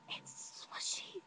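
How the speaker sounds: slow, drawn-out whisper; secretive whisper. Retold or imitated. Telling friend Serena who is nearby, slow whisper.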